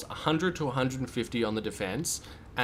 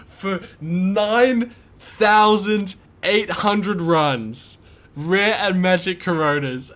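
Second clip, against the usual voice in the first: high, loud, slow amazed yelling; esports caster; 10 semitones higher, 9 dB louder, slower than usual. Real, not acted. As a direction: shout it like you can't believe it. Celebrating long-awaited item drop.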